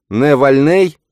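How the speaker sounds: high, loud call; shouting. A narrator's reading. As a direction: holler the foreign word out. Prince keeps shouting foreign words.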